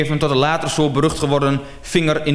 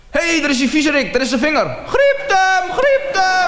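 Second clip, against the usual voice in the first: mock woman's shout; woman screaming; 12 semitones higher than usual. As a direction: scream at the culprit across the mall. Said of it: "En die vrouw schreeuwt".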